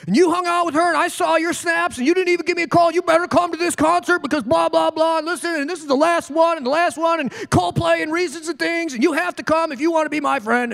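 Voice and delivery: high, whiny mock friend; demanding friend. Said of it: "or do you demand it?".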